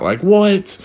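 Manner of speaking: usual speaking voice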